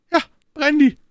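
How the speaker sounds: high, loud urgent call; breathless, frantic cry. A narrator's reading. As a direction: shout it across the room, panting. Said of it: "rief er".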